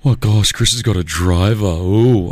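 low, drawn-out sneer; lightly mock a snooty coworker. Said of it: "the guy here that works says".

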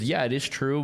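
normal talking voice

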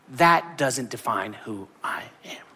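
slow, hushed whisper of resolve. Pretend. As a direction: breathe it out as a quiet vow. Jabez's resolve, voiced quietly.

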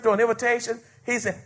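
ordinary delivery

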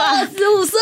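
lightly voiced grandma, pitched high; a touch of an old grandma's blunt tone; a hint of grandma. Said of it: "她说".

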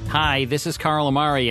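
ordinary talk

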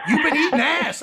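high, loud mock accusation with a laugh. Friends ribbing him about pink eye, loud and laughing.

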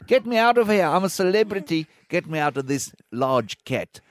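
high mock South African plea, dropping at the end; South African accent. Celebrities begging to get out, pleading South African accent. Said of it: "then you can hear them saying".

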